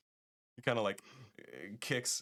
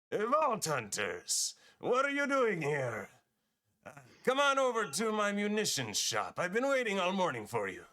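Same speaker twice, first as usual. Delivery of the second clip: loud, high-pitched hail in a put-on accent; Marcus; 12 semitones higher, 5 dB louder than usual. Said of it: "you hear the voice of".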